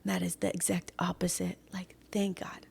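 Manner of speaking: soft whispered thanks; grateful whisper. Real, not acted. Thanking God in a hushed whisper to her friend. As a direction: whisper thanks under your breath.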